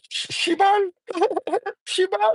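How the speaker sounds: high mocking Shiba with a laugh. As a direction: parody the dorky way people say it. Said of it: "you better say it right".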